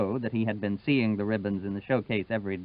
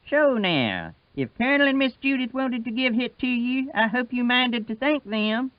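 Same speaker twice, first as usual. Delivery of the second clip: Wash's voice; 12 semitones higher than usual. Acted. Old Wash telling the girl to thank the Colonel, higher voice. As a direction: speak up high as humble old Wash.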